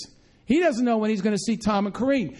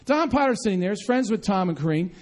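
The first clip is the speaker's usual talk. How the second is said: usual speaking voice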